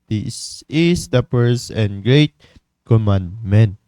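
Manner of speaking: slow, weighty Bible reading; scripture reading. Acted. Preacher reading scripture to the congregation, slow and solemn. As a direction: read the verse slowly and solemnly.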